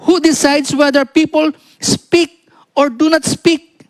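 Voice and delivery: high, slow, spaced-out rhetorical questions; scripture reading. Acted. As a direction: proclaim each question deliberately, pitched high. Reads God's questions from scripture. (mataas, mabagal at madiing pagbasa ng Bibliya)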